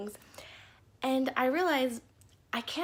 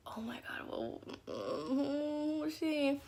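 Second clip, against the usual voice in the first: slow gossiping whisper; mocking whisper; 4 semitones higher, 5 dB quieter, much slower than usual. Kids watching her fall on the field, slow gossiping whisper. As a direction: whisper like onlookers judging her.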